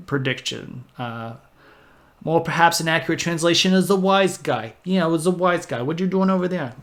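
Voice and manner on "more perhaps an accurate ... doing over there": higher, louder mock Brooklyn wise guy; a jokey Brooklyn wiseguy voice poking fun at the name; Brooklyn accent. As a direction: ham up a New York tough guy. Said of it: "I can't do the Brooklyn accent very well".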